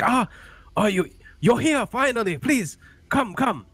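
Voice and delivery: high, urgent welcome; relieved elder. Acted. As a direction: beckon them in with eager relief. Gamemaster voices elder welcoming rescuers.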